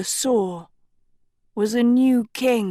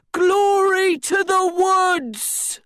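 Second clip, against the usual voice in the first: shouting; 9 semitones higher, slower than usual. A narrator's reading. Crowd cry in square.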